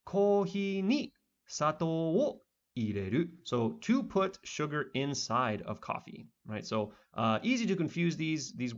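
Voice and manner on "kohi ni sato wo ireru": higher, slow careful reading of the Japanese; example sentence read slowly. Shown as a demonstration. Teacher reading an example sentence to students, slow and high. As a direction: read it out like a textbook model line. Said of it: "another example sentence with coffee".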